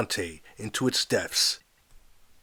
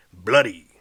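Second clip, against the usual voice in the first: loud preacher shout; 3 semitones lower, 7 dB louder than usual. Acted. Preacher shouting to his congregation, loud. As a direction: shout it from the pulpit. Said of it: "the preacher shouted".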